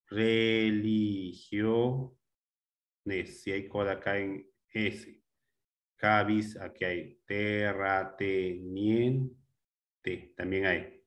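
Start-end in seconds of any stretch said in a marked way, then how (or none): 0.1-2.1 "Religiones" drawn out very slowly, each syllable held. Shown as a demonstration. Teacher checking each syllable for a coda, syllable by syllable. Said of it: "¿Quién no tiene coda?". 7.2-9.3 "terrateniente" slowly split into te-rra-te-nien-te; syllable by syllable. Shown as a demonstration. Teacher hunting for codas aloud, syllable by syllable. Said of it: "¿Quién no tiene coda?".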